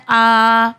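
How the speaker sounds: "آ" held as an open vowel without n; long alif. Shown as a demonstration. Teacher showing the pausing form, long vowel. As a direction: draw out "آ" with no n at the end. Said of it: "اور اسے پڑھا جاتا ہے".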